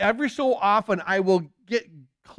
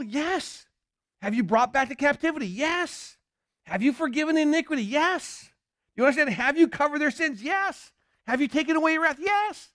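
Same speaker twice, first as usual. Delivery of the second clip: high emphatic yes; 6 semitones higher than usual. Acted. Answering yes to each question.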